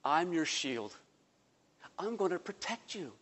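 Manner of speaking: soft, tender comfort; God reassuring. Acted. God softly comforting the fearful Abram, tender and quiet. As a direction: hush his fear gently. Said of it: "but God says".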